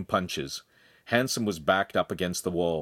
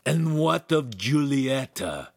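slow, higher, insinuating question; cunning; 6 semitones higher, 3 dB louder, slower than usual. A narrator's reading. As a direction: ask slyly, drawing it out. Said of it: "he asked cunningly".

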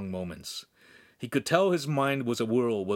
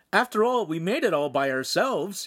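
proud; 7 semitones higher, 3 dB louder than usual. A narrator's reading. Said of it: "he said proudly".

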